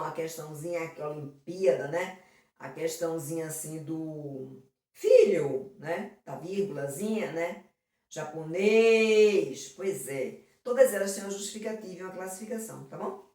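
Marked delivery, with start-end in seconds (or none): 4.9-5.8 "filho" stretched slowly and punched louder; drawn-out word. Shown as a demonstration. Teacher pointing out an example word, "filho" stretched and louder. 8.1-9.7 "Japonês" with a loud, very long final syllable; stretched stressed syllable. Shown as a demonstration. Teacher showing accentuation to students, "Japonês" stretched loudly on the end.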